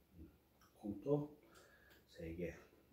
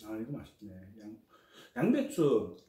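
normal talking voice

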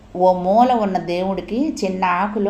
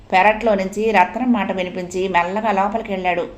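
ordinary delivery

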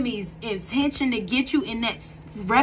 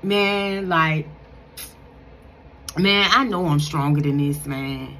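low regretful self-talk; 6 semitones lower than usual. Retold or imitated. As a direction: mutter it low, full of regret. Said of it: "that like".

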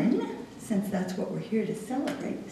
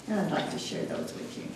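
ordinary talk

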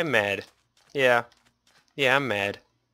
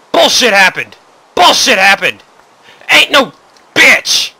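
higher and louder frustrated yelling; loud angry outburst; 6 semitones higher, 6 dB louder than usual. Real, not acted. Gamer yelling at what happened to his base, loud frustrated outburst. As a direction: vent the rage in a shout.

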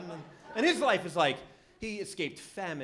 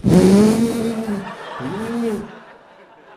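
long, loud imitation of a skidding car; blaring vocal skid sound effect; skidding cab noise; 4 semitones higher, 16 dB louder than usual. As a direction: mimic a cab screeching out of control. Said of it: "the cab goes".

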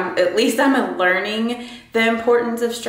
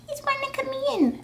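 much higher, softer, quick squeaky voice; squeaky pet talk; 5 dB quieter, faster than usual. Real, not acted. Telling the cat he wants in, squeaky high baby voice.

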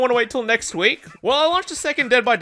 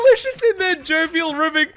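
high, laughing mock quote; mocking repeat; 7 semitones higher than usual. Streamer laughing at his friend's fancy wording, mocking repeat. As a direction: repeat it incredulously through laughter.

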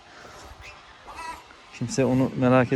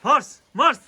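much higher call of the name; high pet call; 12 semitones higher, 4 dB louder than usual. Real, not acted. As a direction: sing out the dog's name. Calling the dog high.

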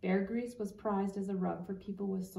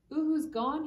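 high, louder distressed cry; 8 semitones higher, 6 dB louder than usual. A narrator's reading. Boy runs to Papa, crying out in distress. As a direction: call it out in distress. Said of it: "he cried".

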